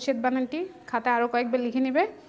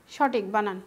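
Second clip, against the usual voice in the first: ordinary talk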